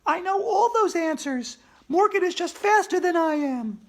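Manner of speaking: put-on smart girl voice. A narrator's reading. Andrea answering her classmate, high girl's voice. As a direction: play the know-it-all girl.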